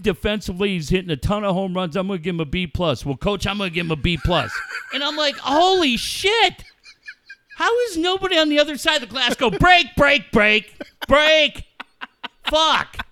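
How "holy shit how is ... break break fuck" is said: exasperated ranting. Retold or imitated. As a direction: build to frantic, exasperated cries. Retold exasperation, pleading break.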